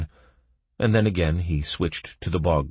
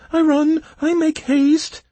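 shrill, plaintive; 8 dB louder than usual. A narrator's reading. Bog creature answering the guard, shrill and plaintive. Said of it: "a shrill and plaintive voice".

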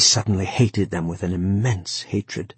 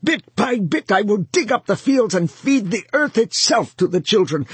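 much higher, louder shriek; 11 semitones higher, 3 dB louder than usual. A narrator's reading. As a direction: scream your defiance. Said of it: "he shrieked at them".

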